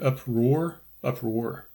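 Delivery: "uproar" repeated, tripping on the roar part; tongue stumbling over "uproar" twice; struggling to say uproar. Real, not acted. Vlogger telling viewers he can't say the word, trying "uproar" again. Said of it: "I can't even say that word".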